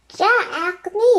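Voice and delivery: high, odd raven croak. A narrator's reading. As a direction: croak it like a caged bird. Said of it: "the raven croaked".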